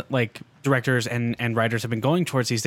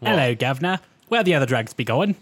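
hammed-up British "guv'nor" accent; mock British accent. Co-host joking about British villains, mock British accent. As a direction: put on a comic British accent. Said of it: "British guy".

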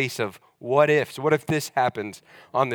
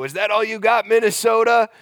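high, loud taunting yell; mock yell at the wind; 10 semitones higher, 6 dB louder than usual. Roommate taunting the Minnesota wind, loud mock yell. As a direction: shout a challenge at the sky. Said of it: "he would always go".

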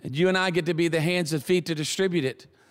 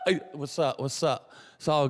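acting cool; 4 semitones lower, 4 dB quieter than usual. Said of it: "act like you cool".

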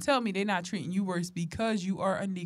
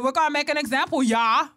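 put-on wrong Swedish accent; 5 semitones higher, 6 dB louder than usual. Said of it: "I was about to do a wrong accent".

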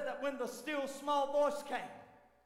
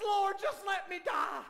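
despairing plea; 9 semitones higher, 6 dB louder than usual. Acted. Elijah begging under the tree.

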